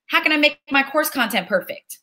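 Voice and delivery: ordinary talk, nothing marked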